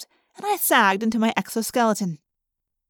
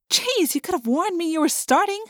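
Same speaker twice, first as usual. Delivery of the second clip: startled; 7 semitones higher than usual. Acted. Startled by sudden antennae.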